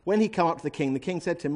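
ordinary delivery

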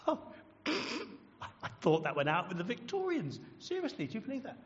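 hammed-up incredulous colleague; mocking scorn. Colleague at work laughing at judgment, scornful. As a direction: scoff with pitch leaping up on the disbelief. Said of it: "people will laugh and mock and scorn".

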